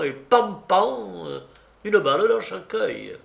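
emphatic, self-important storyteller; boastful hunter. Acted. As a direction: brag grandly about big-game exploits. Tartarin boasts of his hunts. (chasseur vantard)

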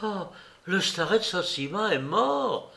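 hushed gasp of dismay, pitch swooping up; shocked cry. A narrator's reading. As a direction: take in terrible news aloud. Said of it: "cria Lyutchenka".

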